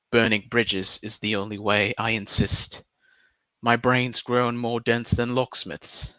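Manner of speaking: measured mock-poetic recital in an English accent. Streamer reading a mock farewell poem, put-on English accent. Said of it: "I'm gonna stop this accent now".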